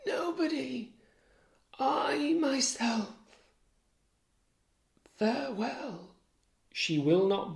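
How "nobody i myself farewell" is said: dying whisper. Acted. Dying wife names herself as killer in a whisper. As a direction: barely get the words out. Dying Desdemona's last words.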